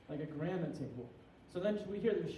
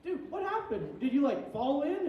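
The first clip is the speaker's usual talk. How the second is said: mock alarm; 8 semitones higher, 5 dB louder than usual. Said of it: "And I'm like".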